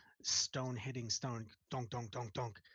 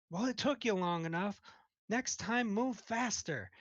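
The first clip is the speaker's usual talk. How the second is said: higher, impatient reprimand; scolding; 8 semitones higher, 4 dB louder than usual. Acted. Cook scolds slow helper.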